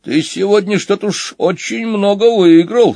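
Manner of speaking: high-pitched suspicious remark. A narrator's reading. Wolf questions Bulmont's winnings, suspicious. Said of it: "с подозрением заметил волк".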